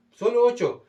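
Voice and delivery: usual speaking voice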